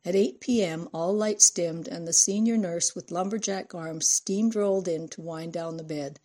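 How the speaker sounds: usual speaking voice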